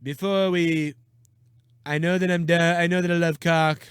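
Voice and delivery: stiff, halting presidential parody; puppet-like politician voice; mock politician. Podcaster putting crude words in a president's mouth, stiff mocking impression.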